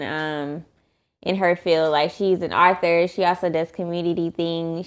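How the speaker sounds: ordinary talk, nothing marked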